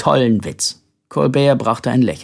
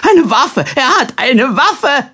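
high, loud panicked cry; frightened scream; 12 semitones higher, 6 dB louder, slower than usual. A narrator's reading. Old lady screaming at the toy gun, panicked. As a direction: shriek in alarm. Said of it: "Die alte Dame stieß einen Schrei aus".

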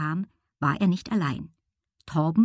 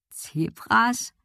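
puzzled question; 5 semitones higher than usual. A narrator's reading. Boy questions the girl's odd remark, puzzled. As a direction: echo the word in puzzled disbelief. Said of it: "fragte Tom".

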